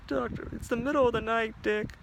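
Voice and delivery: high, strained character voice; voices through the door. Acted. Doctor woken at night, called through door.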